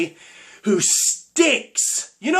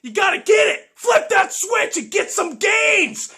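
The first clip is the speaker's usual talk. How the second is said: hype shout; 10 semitones higher than usual. Real, not acted. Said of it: "you got to snap".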